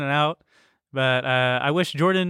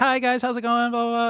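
high, bright service voice; chirpy server greeting the table; waiter voice; 9 semitones higher than usual. Retold or imitated. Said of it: "my waiter voice".

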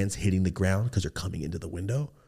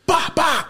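loud gunshot bangs; 4 dB louder than usual. Retold or imitated. Imitating warning shots.

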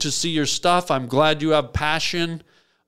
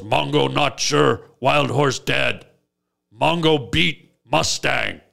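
caveman talk. Retold or imitated. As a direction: plod through it like a simple brute. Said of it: "he still talks like this".

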